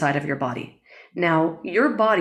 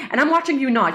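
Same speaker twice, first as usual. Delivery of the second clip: higher-pitched conversational tone; talking up high; 7 semitones higher, faster than usual. Shown as a demonstration. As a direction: demo of a raised speaking voice. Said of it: "listening to me talk like this".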